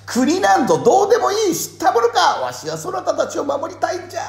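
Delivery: high, loud, desperate cry; desperate shout. Retold or imitated. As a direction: shout it in anguish. Voicing Ieyasu crying out.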